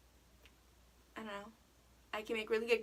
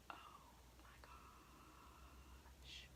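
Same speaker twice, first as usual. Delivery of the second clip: stunned whisper; 27 dB quieter, much slower than usual. Retold or imitated. Realizing wrong name in class.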